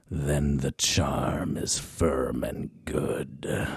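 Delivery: low, slow, spooky incantation, half-whispered at times. Acted. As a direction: chant it like a witches' spell, drawn-out and hushed. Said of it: "use your spooky voice".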